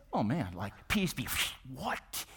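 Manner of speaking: softly acted bewilderment; mildly hushed, incredulous imitation; mock hushed disbelief. John quietly marvels at the calm.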